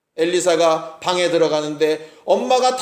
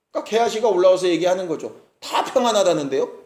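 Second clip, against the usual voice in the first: normal talking voice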